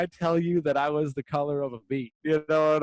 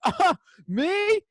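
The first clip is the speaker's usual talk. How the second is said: much higher put-on old man voice; mock old man. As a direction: squeak it like a confused old man. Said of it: "he's like".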